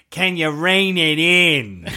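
milk each vowel in a mock Boston drawl; Boston accent impression. Podcaster imitating the actor while joking about the director's request, exaggerated Boston accent. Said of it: "That's generally what he sounds like".

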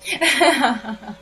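nervous laugh. Real, not acted. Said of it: "Oh, I'm laughing when I'm scared".

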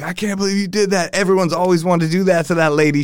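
high-pitched, gushing disbelief; amazed approval. Retold or imitated. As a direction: imitate thrilled bystanders cheering someone on. Club staff congratulating her in story.

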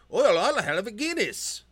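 high, loud mock Irish accent. Showing off his Irish accent to his co-host, high and loud. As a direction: ham up a stage Irish brogue. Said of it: "My Irish accent".

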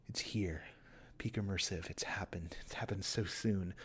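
low, hushed mock wonder; softly stunned, as if it has finally come; awed whisper. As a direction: drop to a reverent near-whisper. Mock awe after the big rant.

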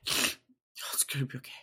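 whispered, half-asleep mumble; breathy night-terror mumble; imitating sleep-talk. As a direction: murmur it like talking in a nightmare. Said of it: "waking up every ten to twenty minutes going".

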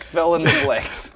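"Fill in the blanks" drawn out slowly, each word stretched; slow teasing repeat. Shown as a demonstration.

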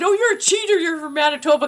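high, playful imitated accusation; teasing accusation. Actor teasing her about her accent, high playful accusation. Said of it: "and he said".

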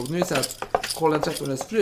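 ordinary talk, nothing marked

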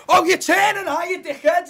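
sarcastic road rage. Retold or imitated. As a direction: jeer at the driver who won't indicate. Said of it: "And then you're like".

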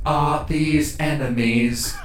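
mechanical robot questioning; robot character. Acted. Slender warforged sizing up the party, robotic voice. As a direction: ask it like a machine assessing targets. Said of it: "the slender one turns to you all and says".

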